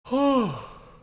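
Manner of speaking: big, high sigh falling away; loud sigh. Real, not acted. Streamer taking a break from coding, loud sigh. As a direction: heave a loud, audible sigh. Streamer sighs during a pause.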